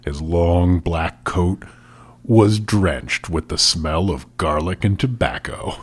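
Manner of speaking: low, hushed storyteller narration; dramatic story reading. Acted. Podcast host reading a villain's entrance, hushed and dramatic. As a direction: read it like a menacing book scene.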